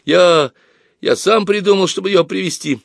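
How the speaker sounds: put-on high, halting boy's voice; acted guilty schoolboy; timid babbling. A narrator's reading. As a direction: confess in a flustered childish stammer. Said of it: "пролепетал Боря".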